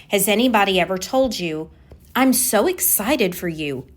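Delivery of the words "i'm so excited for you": normal talking voice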